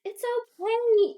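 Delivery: very high, drawn-out, loud comforting; imitated reassurance. Friend consoles the crying teller, exaggerated reassurance. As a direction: coo it slowly, mock-soothing.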